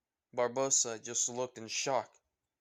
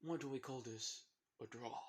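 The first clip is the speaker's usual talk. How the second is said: soft, offhand pirate; quiet, understated pirate quip; Jack Sparrow; 11 dB quieter than usual. Acted. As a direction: lightly play a cool pirate, hushed. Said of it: "he pulls out the gun and just says".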